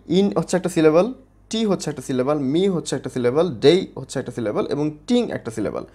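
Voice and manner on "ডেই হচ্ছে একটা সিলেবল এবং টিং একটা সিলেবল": ordinary delivery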